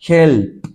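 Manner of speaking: "help" with p popped as a puff of air; airy P ending. Shown as a demonstration. Teacher has students feel the air, "help" with a popped p. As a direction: finish "help" on voiceless bursts of p. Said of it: "Es solo aire que está saliendo".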